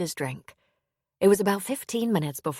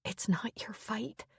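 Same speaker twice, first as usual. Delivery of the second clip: slow unvoiced whisper, much softer; 5 semitones higher, 8 dB quieter, slower than usual. A narrator's reading. Hannah telling Owen it's her fight, slow whisper. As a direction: breathe it out slowly, pleading.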